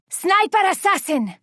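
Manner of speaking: high, sharp alert to the team; urgent warning call. Acted.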